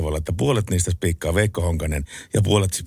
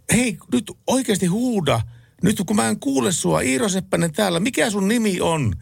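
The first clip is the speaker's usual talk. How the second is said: retold yelling; 11 semitones higher than usual. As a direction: bellow into the phone. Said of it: "Sitten mä karjusin". (kovaa huutoa puhelimeen)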